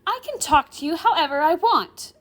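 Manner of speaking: put-on high, loud, defiant girl. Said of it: "also raising her voice".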